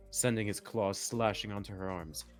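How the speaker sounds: usual speaking voice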